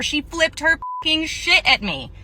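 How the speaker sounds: usual speaking voice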